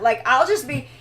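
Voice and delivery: ordinary talk, nothing marked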